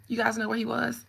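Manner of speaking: ordinary talk, nothing marked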